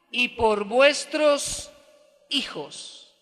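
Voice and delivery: very slow, spaced-out phrases; solemn scripture reading. Acted. Jesus turning to weeping women, solemn and slow. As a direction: declaim it strongly, then let phrases drop.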